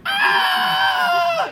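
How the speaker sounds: mock children's shriek, high and loud; kids' scream. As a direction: shriek like frightened first graders. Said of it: "And they would run to the wall".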